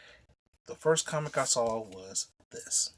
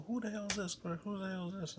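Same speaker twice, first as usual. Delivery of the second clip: higher, demanding challenge to the intruder; mock angry boyfriend; 8 semitones higher, 4 dB quieter than usual. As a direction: bark the question twice, pitched up. New boyfriend confronts him.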